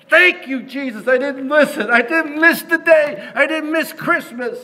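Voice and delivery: high-pitched, overjoyed exclamations; imitated excited Scrooge. Scrooge finds he didn't miss Christmas, acted high excitement. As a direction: cry it out in acted relief and delight. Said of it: "Scrooge was so excited".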